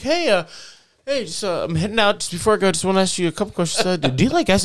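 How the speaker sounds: chirpy fake-casual boss asking out of the blue; imitating his boss. Boss popping into the office door, mock chipper high voice.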